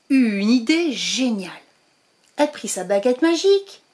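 ordinary delivery

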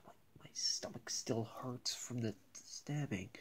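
soft whispered complaint; pained whisper. Acted. Stabbed toy student telling class, pained whisper. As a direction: whisper it like it still hurts.

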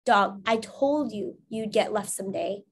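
ordinary delivery

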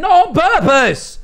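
high, slow, indignant protest; mock indignant yell. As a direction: yelp it in fake outrage. Accused of lying by chat.

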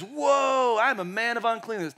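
a prophet overwhelmed by holiness; awestruck whoa. Retold or imitated. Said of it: "And he says".

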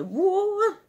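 startled whoa. Retold or imitated. Kid retelling the movie, loud high startled whoa. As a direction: yelp it out like a cartoon critter falling. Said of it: "and then it's like".

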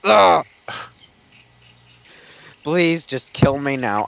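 ordinary talk

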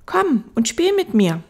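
put-on high, loud child voice; little prince. Little prince asking the fox to play, high child voice. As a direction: invite like an eager small boy.

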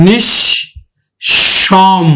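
নিঃসম broken into nish, pause, shom; split double sh. Shown as a demonstration. Teacher showing the letter said twice, split nish, shom in নিঃসম. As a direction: hold each half of নিঃসম separately, sh on both. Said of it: "দুইবার উচ্চারিত হবে".

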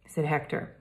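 plain reading voice

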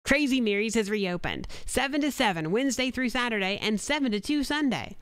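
bright, punchy commercial voice-over; radio ad read. Acted. Voice actor recording a spot for a diner, upbeat ad read. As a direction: sell the restaurant reopening like a local radio spot.

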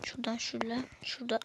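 whispered under breath; hushed whisper mid-jump. Real, not acted. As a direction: whisper it to yourself while concentrating. Focused on tricky jump.

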